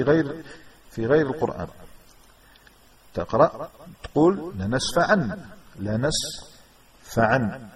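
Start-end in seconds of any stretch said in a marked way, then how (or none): 4.6-5.4 "لنسفعا" ending in a clear n; final nun sound. Shown as a demonstration. Teacher showing non-Quranic reading, final nun sound. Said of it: "تكتب النون الساكنة". 5.8-7.7 "لنسفعا" slowly in two parts, la-nas, fa-an; final nun, broken up. Shown as a demonstration. Teacher spelling it out for students, final nun sound. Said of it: "تكتب النون الساكنة".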